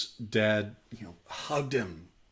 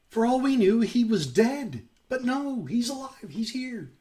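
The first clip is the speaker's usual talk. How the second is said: joyful high father; 11 semitones higher, 4 dB louder than usual. Acted. Father rejoices, son is alive.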